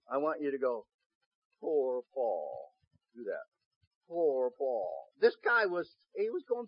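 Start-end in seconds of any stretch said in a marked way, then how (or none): 1.6-2.7 slow, drawn-out pitying sigh of words; poor-thing commiseration; mock pity. Shown as a demonstration. Models pitying phrase for congregation. 4.1-5.1 slow, drawn-out pitying sigh of words; poor-thing commiseration; mock pity. Shown as a demonstration. Models pitying phrase for congregation.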